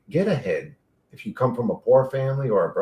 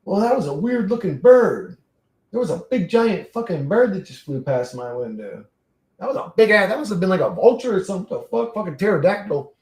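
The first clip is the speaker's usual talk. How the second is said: startled; 5 semitones higher, 3 dB louder than usual. Real, not acted. Bird flew past window.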